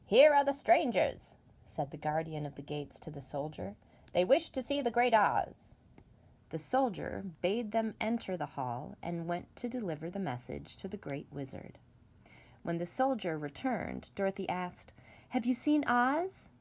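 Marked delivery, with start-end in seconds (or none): 0.0-1.4 a slightly higher, louder gate guardian; guardian announcing. Acted. Guardian announces visitors to soldier. 4.1-5.7 a slightly higher, louder gate guardian; guardian announcing. Acted. Guardian announces visitors to soldier. 15.3-16.5 put-on higher, hopeful girl's question; young Dorothy asking. Dorothy asks the soldier.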